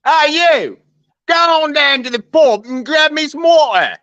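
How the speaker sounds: high-pitched hammed-up English accent. Shown as a demonstration. American friend showing off to a British listener, hammed-up English accent. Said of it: "Here's my English accent".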